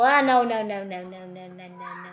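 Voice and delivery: sudden loud no, fading off; getting killed mid-game, a loud dismayed no; dismayed cry. Real, not acted. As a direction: yelp then trail off in dismay. Just got killed in game.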